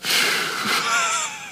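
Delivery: long, audible exhale; slow, heavy sigh of breath; deep breath. Shown as a demonstration. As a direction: let out a big breath. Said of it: "Let's take a deep breath".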